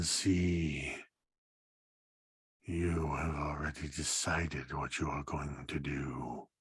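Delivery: grind it out like shifting stone; low gravel vigilante growl. Acted. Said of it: "like heavy stone against stone".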